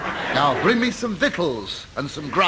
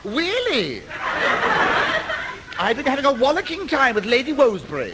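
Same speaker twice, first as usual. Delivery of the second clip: foppish; 8 semitones higher than usual. Acted. Hero camps it up.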